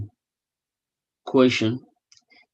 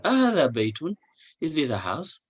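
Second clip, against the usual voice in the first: هذا بيت with the pitch climbing at the end; questioning rise; 7 semitones higher, much faster than usual. Shown as a demonstration. Teacher asking a friend, high questioning rise. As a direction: lift the voice into a question. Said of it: "You ask your friend".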